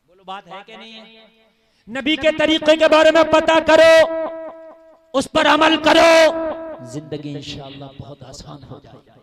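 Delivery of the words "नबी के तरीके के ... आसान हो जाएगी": loud, driving sermon voice; fiery preaching. Acted. Preacher to jalsa crowd, loud fiery preaching. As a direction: preach it out to the crowd at full volume.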